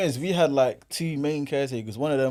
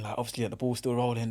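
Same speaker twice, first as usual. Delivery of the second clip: ordinary talk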